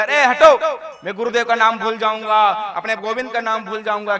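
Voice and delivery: loud, annoyed brush-off; irritated cowherd. Acted. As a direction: shove her away to keep chanting. Cowherd shoos Lakshmi away. (चिढ़ा हुआ ग्वाला, ज़ोर से झिड़कता)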